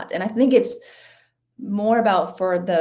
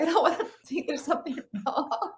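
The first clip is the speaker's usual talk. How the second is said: higher, giggling through the words; laughing self-quote; 9 semitones higher, 4 dB quieter than usual. Retold or imitated. Runner hiding tears behind sunglasses, laughing it off higher. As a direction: laugh it off, pitched up. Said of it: "'cause I was like".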